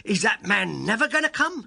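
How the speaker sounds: page boy voicing an impatient cry; high, impatient question; impatient gentleman. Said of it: "he outs into the passage and he cries".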